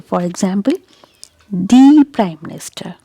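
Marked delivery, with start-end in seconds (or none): 1.5-3.0 "the" stretched to "thee" in "the Prime Minister"; emphatic the. Shown as a demonstration. Teacher shows someone is important, stressed "thee". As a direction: lean hard on a long "thee" for "the". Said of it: "when you want to emphasize something".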